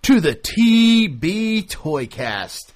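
slow booming announcer welcome. Acted. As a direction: stretch it out like a ring announcer. Host's grand announcement.

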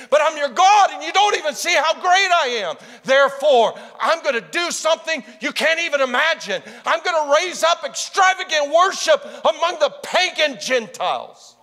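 loud, high preaching, building in fervour; preaching at full force. Acted. Preacher voicing God to the congregation, full-force preaching. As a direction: proclaim it to the whole room.

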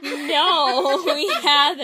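loud, high, laughing reply; exaggerated loud group answer; mock exclamation. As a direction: blurt it loudly through laughter.

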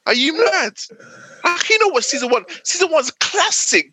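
high, outraged disbelief; real mock outrage; incredulous rant. Real, not acted. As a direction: scold them for skipping it. Ranting at friends about show.

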